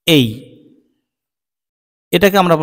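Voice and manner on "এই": a full diphthong এই; এই gliding, not flat; ay sound. Shown as a demonstration. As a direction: glide from এ to ই. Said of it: "এই sound produce করছে".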